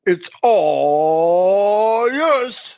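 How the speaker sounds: "all" stretched very long, rising and loud; drawn-out handoff. Real, not acted. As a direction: hand over with a long, swooping announcer-like flourish. Playful handoff to co-host.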